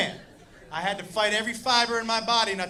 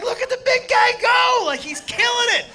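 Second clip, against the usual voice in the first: high, loud, hyped-up hollering; excited cheering; 11 semitones higher, 6 dB louder than usual. Retold or imitated. Comic cheering big guy doing kicks, excited shout. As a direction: cheer on the dancer from the crowd. Acting his own amazed cheering.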